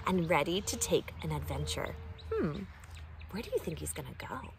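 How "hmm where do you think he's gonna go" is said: quick soft question. Acted. Host hushed question to kids.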